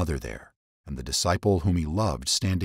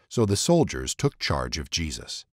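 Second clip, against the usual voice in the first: usual speaking voice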